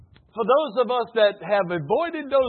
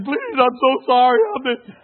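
mock pleading husband; 5 semitones higher, 8 dB louder than usual. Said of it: "on their knees crying".

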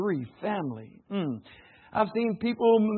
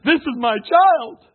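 possessive claim; 5 semitones higher, 9 dB louder, slower than usual. Retold or imitated. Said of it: "one spouse said".

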